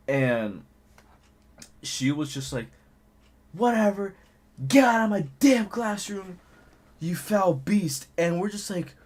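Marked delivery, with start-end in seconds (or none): 3.5-8.1 high, exasperated mock scolding; angry teacher. Teacher kicking student out of her classroom, acted anger. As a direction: dismiss him as a teacher at the end of her patience.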